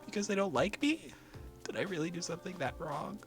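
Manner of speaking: high, breaking, tearful questions; high sobbing cry. Acted. Asking Jisoo why everyone leaves, sobbing high. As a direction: plead through sobs, voice cracking.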